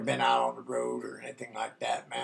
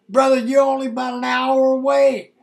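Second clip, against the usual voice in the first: raised high and louder, retold good news; excited friend; 10 semitones higher, 7 dB louder than usual. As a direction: imitate a friend calling out happy news. Friend breaks good news by phone.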